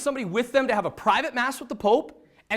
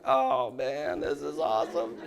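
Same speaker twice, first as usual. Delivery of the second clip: feigned joy; 8 semitones lower, 5 dB quieter, slower than usual. Retold or imitated. Feigns joy while dismayed.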